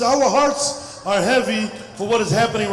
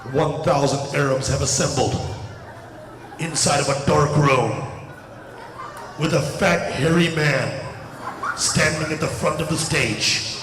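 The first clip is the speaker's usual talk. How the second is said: mock news report; 10 semitones lower than usual. Comic telling the crowd how the news would report them, ominous anchor. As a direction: intone it like a scary TV exposé.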